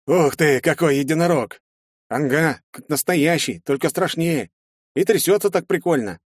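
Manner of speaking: excited clanmate voice. Acted. Clanmates gawk at the hero.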